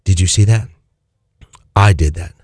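the Spirit's voice, pitched low; a low, intimate inner voice speaking; God's quiet voice whispering to the heart. Retold or imitated. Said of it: "with that quiet little voice".

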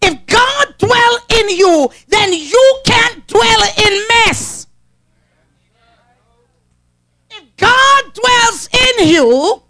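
slow, high preaching cadence; drawn out like a pulpit preacher. Acted. Preacher declaiming the text to the church, slow and lifted.

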